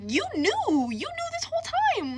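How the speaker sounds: high-pitched acted outrage; mad, retold. Confronting his friend about the secret, mad. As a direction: re-enact an indignant accusation, voice shooting up. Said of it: "I was, I was mad".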